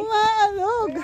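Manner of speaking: shrieking as the bridge sways; on a wobbly bridge. Real, not acted. Bridge still shaking.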